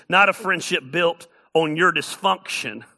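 ordinary delivery

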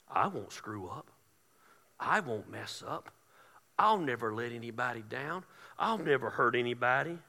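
low, hushed mimicry of an arrogant thinker; mock self-assurance. Preacher mimics someone thinking they never fail, low and mocking. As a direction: recite each vow low, mocking its smugness.

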